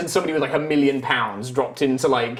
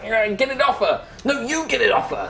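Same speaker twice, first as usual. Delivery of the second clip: bickering goons; 9 semitones higher than usual. Acted. GM voices two bickering goons.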